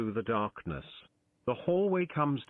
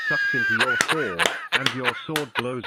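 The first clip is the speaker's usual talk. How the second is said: loud; 7 dB louder than usual. A narrator's reading. Narrating ghost being sucked in.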